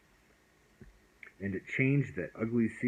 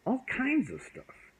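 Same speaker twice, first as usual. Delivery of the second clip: high and slow, showing off; proud little boy. Acted. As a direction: stretch it out proudly. Boy boasting his toys.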